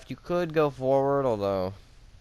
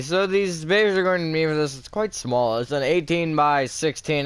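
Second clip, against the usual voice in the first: ordinary talk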